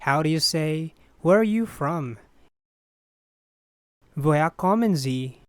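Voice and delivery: usual speaking voice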